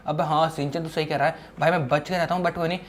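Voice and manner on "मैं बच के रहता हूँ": ordinary talk, nothing marked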